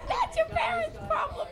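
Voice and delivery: high, loud play-acted granny; eighty-year-old grandma. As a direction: start high and loud, drop down laughing. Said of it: "[name] will be an eighty-year-old".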